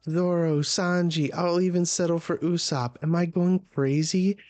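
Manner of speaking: distressed cry for help. Acted. Lost Nami calls to her crewmates for rescue, acted high-pitched distress.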